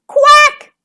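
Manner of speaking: very loud, high quack; shrieked duck alarm; outraged quack. A narrator's reading. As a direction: squawk like a furious duck. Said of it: "she cried".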